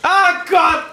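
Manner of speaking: panicking gamer. Real, not acted. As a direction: scream and laugh as it catches you. Wird vom Monster erwischt. (lachend panisch schreien)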